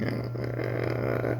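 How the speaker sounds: put-on high squealing kid; kid disgusted. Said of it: "I was like".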